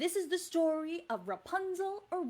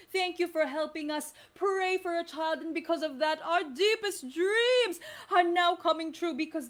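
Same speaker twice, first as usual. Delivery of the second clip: happy husband; 4 semitones lower than usual. Acted. Husband thanking the children for praying, lower and proud. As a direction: answer as the glad husband.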